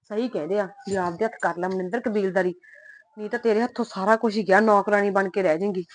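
low, soft grumbling under her breath; muttering to herself. Acted. Wife alone, fretting about husband and mother-in-law, muttered softly. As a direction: complain quietly so nobody hears.

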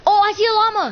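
loud, high, thrilled shout; excited shout. Real, not acted. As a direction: yell it out with sudden glee. Excited spotting a llama.

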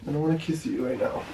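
quiet, tearful fake crying. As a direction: act like you're holding back tears. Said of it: "Why are you crying?".

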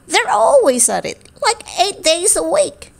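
high little beetle voice. Acted. Beetle complaining about the frogs, high and small. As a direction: play a tiny gossipy beetle.

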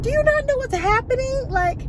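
high-pitched mock disbelief; incredulous. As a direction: ask it as if they must be oblivious. Mock disbelief at oblivious YouTubers.

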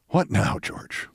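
exasperated, low and weary; Edward Bennett Williams. Retold or imitated. Owner leaning out to answer George, exasperated. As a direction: drop low and soft on the name, fed up. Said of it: "said with evident exasperation".

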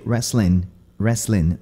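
"Wrestling" ending in a plain n, "wrestlin'"; dropped G. Shown as a demonstration. Teacher shows the apostrophe's effect, "Wrestling" with dropped G. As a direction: drop the final g of "Wrestling". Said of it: "esse apóstrofo tirando o G".

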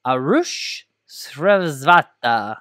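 a name drawn out slowly in a mock Russian accent; playful Russian accent, stretched slow. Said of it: "I said it with a Russian accent".

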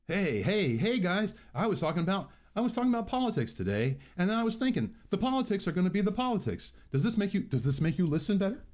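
high, bouncy, hammed-up vlogger chatter; mock YouTuber voice. Vlogger parodying YouTubers who talk like this, high and hammed-up. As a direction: overdo the peppy YouTuber talk. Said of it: "And they have to talk like this".

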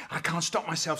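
normal talking voice

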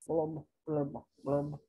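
low, soft blurb sounds; glum bubble blurbs. Acted. Pouting fish in the ocean, low glum blurbs. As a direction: sink into sad bubbles.

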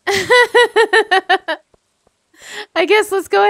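laughing through the words; giggling embarrassed. Real, not acted. Laughing at herself.

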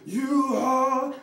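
a high, loud rock-singer wail. Retold or imitated. Speaker to audience, imitating a rock singer performing, high and loud. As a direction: belt it out like a raspy rock star on stage.

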